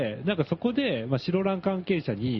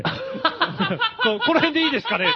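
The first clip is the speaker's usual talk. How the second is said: can't get the line out for laughing; laughing while quoting; 5 dB louder, faster than usual. Real, not acted. Host retelling the boy's pose to co-hosts, laughing through it. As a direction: quote broken up by real laughter, pitched high.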